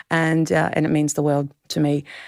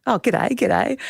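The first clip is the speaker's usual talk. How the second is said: higher, playful Australian greeting; Australian accent; 6 semitones higher, slower than usual. Retold or imitated. Two Australians meeting by phone in Dublin, retold in Australian accent. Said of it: "two Australian accents".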